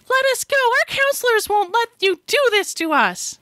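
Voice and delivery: put-on very high squeaky kid voice; Chipmunk's high voice. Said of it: "'cause Chipmunk has the high voice".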